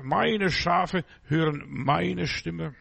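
ordinary delivery

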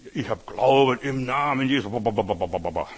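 loud burst trailing into rapid babble; hammed-up believer running off into babble; mock zealot. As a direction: parody fervent faith talk, sliding down into ba-ba-ba. Mocks blindly fervent faith talk.

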